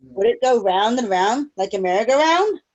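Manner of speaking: usual speaking voice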